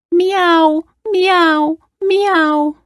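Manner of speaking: high meows falling at the end; screeching cat. A narrator's reading. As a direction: imitate an upset cat. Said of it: "each of the animals joined in".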